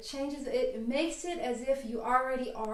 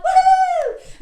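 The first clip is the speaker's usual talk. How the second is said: high shrieking woohoo; cheerleader whoop; 15 dB louder than usual. Pretend. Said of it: "cheerleaders are silly and goofy".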